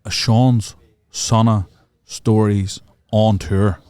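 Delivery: slow, deliberate segment title; slow announcement. Shown as a demonstration. Host pitching a new segment before signing off, slow announcement. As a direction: space out every word of the title. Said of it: "Say that really fast".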